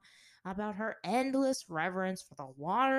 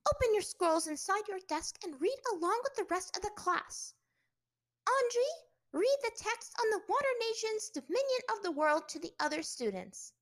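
stern teacher; 11 semitones higher, 5 dB louder than usual. Acted. Teacher orders class.

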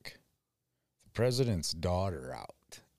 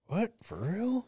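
mock surprise. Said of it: "Shelby reacting".

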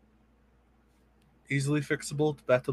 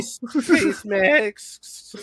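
mock drawn-out voice; 7 semitones higher, 5 dB louder, much slower than usual. Mocking merch list, drawn out.

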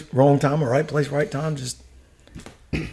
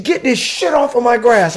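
high, drawn-out mock command; retold angry order; 7 semitones higher, slower than usual. Sergeant major ordering soldier to clear his gear, mock angry. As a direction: stretch out the order, pitched up.

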